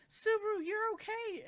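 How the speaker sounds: high, loud delighted call; excited relief. Retold or imitated. She runs to Subaru, excited and relieved. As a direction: cry out his name, thrilled.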